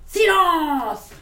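loud, high "silence!" barked out; commanding shout. Shown as a demonstration. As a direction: order the whole room to freeze. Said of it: "j'aimerais être comme lui, comme ça, faire". (« silence! » lancé fort, comme Max)

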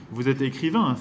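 ordinary delivery, nothing marked

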